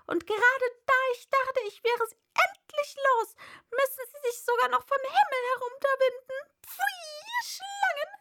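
high, loud screeching bird rising to a cry. A narrator's reading. Pigeon raising her voice at the snakes, loud and shrill. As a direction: shriek it out, outraged pigeon. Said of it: "fuhr die Taube mit erhobener Stimme fort".

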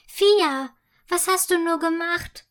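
mother calling out to her daughter in dismay; alarmed mother. Acted. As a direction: rush up in dismay.

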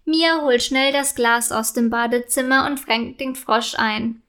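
usual speaking voice

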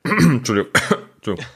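coughing after swallowing wrong; choking on a drink. Real, not acted. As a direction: apologize while still choking. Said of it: "Jetzt hab ich mich verschluckt".

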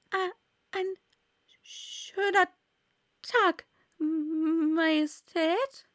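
high, faint and stammering; faint frightened voice. A narrator's reading. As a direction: stammer a timid greeting, slowly. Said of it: "mit leiser, schwacher Stimme".

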